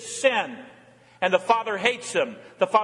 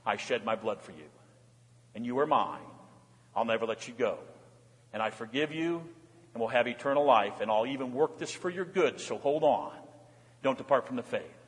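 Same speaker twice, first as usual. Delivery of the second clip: tender reassurance of the Lamb; 7 semitones lower, 6 dB quieter than usual. Acted. The Lamb reassures the suffering believer, soft and tender. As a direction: lower and softer, gently reassuring. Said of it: "the Lamb says".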